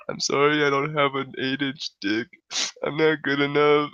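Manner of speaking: high mock-weepy self-pity; imitated tearful apology. Crying drunk confesses inadequacy to girl, high mock tearful voice. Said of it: "he starts crying".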